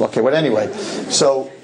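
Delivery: ordinary talk, nothing marked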